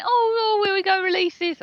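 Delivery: imitating a cohost fretting, hammed up; mock-tearful Essex whine about releases. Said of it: "you sat there and went".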